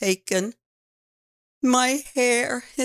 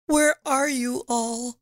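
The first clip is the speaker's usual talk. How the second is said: plain reading voice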